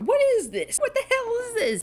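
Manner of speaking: high, loud, baffled cry; a man gawking at strangers in robes; baffled Vernon. Retold or imitated. Vernon gawking at the robed people, high and baffled. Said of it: "He's just like".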